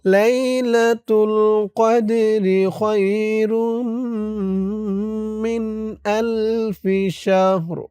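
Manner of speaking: slow melodic chant; Quran recitation. Shown as a demonstration. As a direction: stretch each word like tilawah. Recites the verse again.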